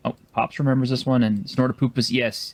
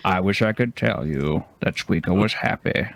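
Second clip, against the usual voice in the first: Morgan Freeman; 4 semitones lower than usual. Retold or imitated. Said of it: "the Morgan Freeman narration for that".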